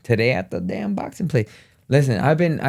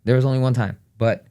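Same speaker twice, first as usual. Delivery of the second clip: usual speaking voice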